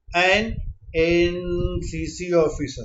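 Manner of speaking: very slow, each sound drawn out; slow motion. Shown as a demonstration. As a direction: stretch every syllable slowly. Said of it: "इसको हम slow motion में देखते हैं".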